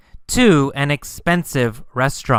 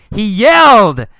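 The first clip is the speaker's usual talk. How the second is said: "yelled" punched high and loud; stress on yelled; 5 semitones higher, 6 dB louder than usual. Shown as a demonstration. Teacher correcting whisper to yell, "yelled" stressed high and loud. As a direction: swell loudly on "yelled".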